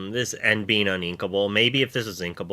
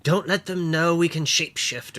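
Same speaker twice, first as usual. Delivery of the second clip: makeup TikTok lady; 7 semitones higher than usual. Retold or imitated. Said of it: "that makeup TikTok lady".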